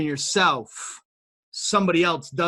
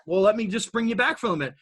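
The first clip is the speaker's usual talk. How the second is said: ordinary delivery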